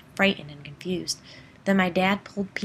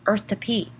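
loud call, pitched higher; retold yell; 4 semitones higher, 6 dB louder than usual. Boy yelling at his zoned-out brother, loud call. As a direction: snap him out of it with a shout. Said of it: "So I yelled at him".

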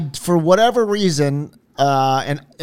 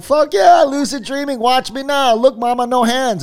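mock cocky cheer, much higher; 10 semitones higher than usual. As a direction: crow like a show-off kid. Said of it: "I'm all cocky".

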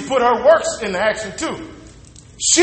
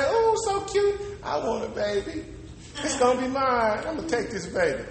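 Pharaoh's daughter; 11 dB quieter than usual. Retold or imitated. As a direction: coo over the baby.

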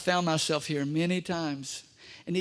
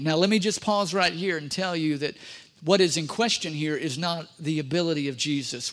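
ordinary talk, nothing marked

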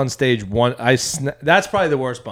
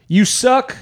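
mock heckler voice; 6 semitones higher, 4 dB louder than usual. Heckler quoted, laughing.

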